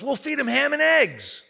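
high, loud proud chicken voice; the chicken pitching its clever plan; chirpy chicken. Acted. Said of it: "And the chicken goes".

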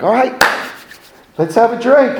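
high, loud mock cheery invite. Pilot imitating himself inviting crew for a drink, hammed-up cheer. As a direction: ham up a chummy drinking pal. Retelling his cheery self.

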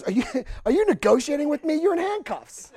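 high, laughing disbelief; incredulous laughing. Retold or imitated. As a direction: laugh in his face in disbelief. Officer incredulous at negotiation.

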